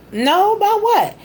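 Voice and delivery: high-pitched, calling back a question; retold puzzled reply. Wife answering husband in the shower, puzzled call back.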